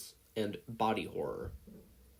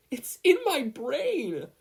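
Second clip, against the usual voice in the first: high, loud, slow wail; panicked scream; 7 dB louder, much slower than usual. Acted. Katsuki wails to Izuku about the worm, loud slow panic. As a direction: wail in pure terror.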